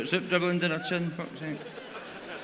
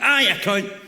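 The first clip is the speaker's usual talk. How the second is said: mock yell; 4 semitones higher, 3 dB louder than usual. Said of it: "jump out from behind their seat".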